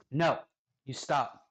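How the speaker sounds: normal talking voice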